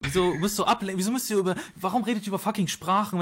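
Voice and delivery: real heated frustration; exasperated. Real, not acted. Exasperated outburst in heated argument.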